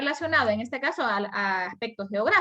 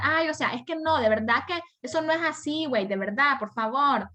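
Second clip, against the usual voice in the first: higher-pitched, protesting fresa voice; 4 semitones higher than usual. Acted. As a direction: imitate a whining Mexican-style valley girl. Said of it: "el estereotipo que habla una fresa".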